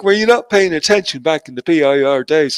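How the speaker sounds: usual speaking voice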